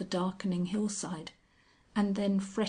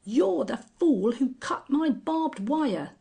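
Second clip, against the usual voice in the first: higher, louder menacing accusation; threatening; 7 semitones higher, 6 dB louder than usual. A narrator's reading. Sir John accusing the Angel, threatening. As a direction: threaten him with it.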